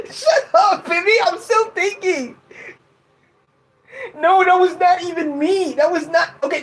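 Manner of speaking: high-pitched giggly protest; laughing protest. Real, not acted. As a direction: protest through real laughter, voice shooting up. Laughing protest at teasing friend.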